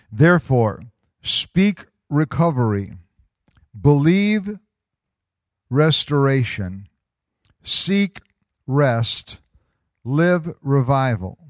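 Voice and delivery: ringing proclamation in short, separate phrases; loud, high, emphatic declaration; slow, deliberate reading. Real, not acted. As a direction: charge the congregation, pausing after each command. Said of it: "gonna read it kinda slow".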